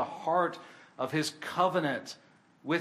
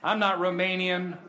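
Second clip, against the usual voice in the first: louder, lifted proclamation; retold emphatic declaration; 5 semitones higher, 4 dB louder than usual. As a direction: declare it boldly as the retold soldier. Soldier declares shared heavenly citizenship.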